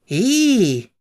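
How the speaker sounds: high, loud Yorkshire "Eee"; proud exclamation. A narrator's reading. Martha bursting with pride at Dickon's drawing. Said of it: "said Martha with great pride".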